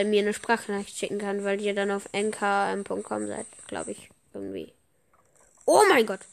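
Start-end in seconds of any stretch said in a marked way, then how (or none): 5.7-6.2 sudden higher, louder cry of shock; shocked outburst. Real, not acted. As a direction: blurt it out stunned. Shocked reaction during game.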